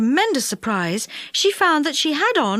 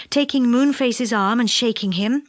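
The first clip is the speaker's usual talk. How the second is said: ordinary delivery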